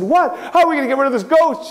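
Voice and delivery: put-on high frightened girls' voice; scared girls. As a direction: squeak it out in panic. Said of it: "They said".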